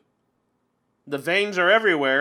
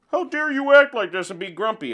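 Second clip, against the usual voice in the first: high, loud mock scolding; mock indignation; 6 semitones higher, 5 dB louder than usual. Critic scolds the player for being grumpy, mock indignant. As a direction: play an outraged critic telling someone off.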